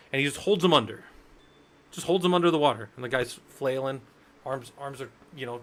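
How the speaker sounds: usual speaking voice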